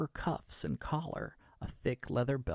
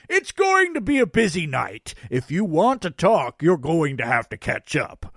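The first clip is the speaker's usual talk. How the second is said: loud, high Santa holler; Santa calling back; 4 semitones higher, 9 dB louder than usual. A narrator's reading. Santa backing off toward the trees, calling back loudly. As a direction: call it back for them to follow.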